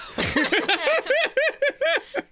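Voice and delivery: real, high-pitched laughing fit. Real, not acted. Player cracking up at own line, high laugh. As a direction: break into a long high giggle.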